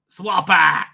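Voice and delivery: "Swapper!" called out loud; playful exclamation. Real, not acted. Streamer repeating site name, "Swapper!" loudly exclaimed. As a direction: punch out "Swapper!" as a comic cry.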